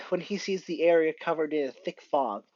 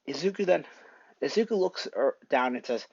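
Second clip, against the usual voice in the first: plain reading voice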